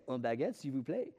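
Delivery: put-on a light French accent on un baguette s'il vous plaît; French phrase. A tourist orders bread in French, lightly accented. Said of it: "I say".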